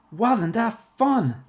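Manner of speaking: high, loud, slow and drawn out; excited exclamation. A narrator's reading. Bradley to Jeff after the party, exclaiming. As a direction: burst out, stretching each word with delight. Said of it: "Bradley exclaimed".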